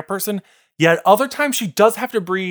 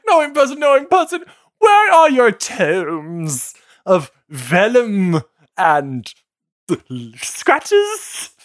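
proud merchant, half sung; gruff proud merchant pitch; 3 semitones higher, slower than usual. Retold or imitated. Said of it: "This is [name] trying to do Potion Seller".

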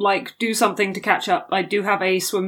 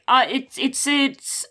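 ordinary delivery

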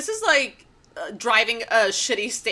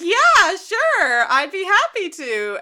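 high, over-eager agreement; Rain; 7 semitones higher, 4 dB louder, slower than usual. Retold or imitated. Imitating Rain agreeing to check on George, high and eager. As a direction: pipe up in mock willingness, starting loud. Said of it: "And Rain's like".

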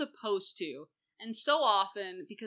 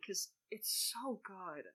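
soft, slow, drawn-out praise; hushed admiration; 11 dB quieter, slower than usual. Real, not acted. Fan raving about the actor, hushed and slow. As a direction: let it sink to a slow, reverent hush.

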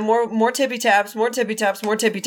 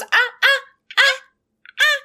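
high tongueless moaning; urgent wordless ah sounds; warning ah ah ah. Retold or imitated. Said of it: "She goes".

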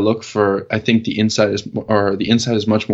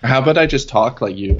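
ordinary talk

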